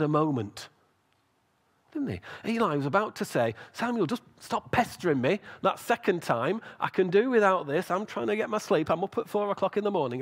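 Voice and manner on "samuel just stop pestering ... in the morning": high-pitched tired grumbling rant; exasperated complaint. Acted. Eli trying to sleep, pestered by Samuel, exasperated. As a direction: act fed up at being woken.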